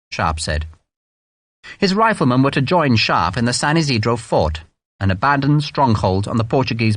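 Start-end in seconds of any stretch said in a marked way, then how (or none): none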